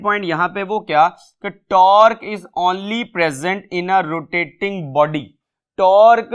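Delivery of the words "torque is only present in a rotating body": words spaced out for students to copy; slow, deliberate classroom read-out; slow dictation. Shown as a demonstration. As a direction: dictate it slowly. Reads statement for notes.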